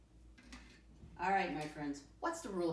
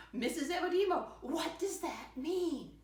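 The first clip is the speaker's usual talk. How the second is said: curious student voice; 9 semitones higher, 4 dB louder than usual. Pretend. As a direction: play a kid raising a question, much higher. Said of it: "you should be asking yourself something".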